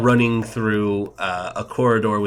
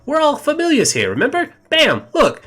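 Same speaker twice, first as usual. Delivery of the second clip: much higher, chirpy small creature; pleased with his own trick; Emerald showing off; 4 dB louder than usual. Acted. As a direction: Emerald reassures the party, high and chirpy. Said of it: "he's just like".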